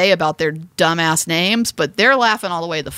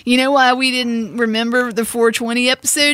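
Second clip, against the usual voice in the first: stoner voice; 4 semitones higher than usual. Pretend. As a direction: talk like a mellow stoner. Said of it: "Do you like my stoner voice?".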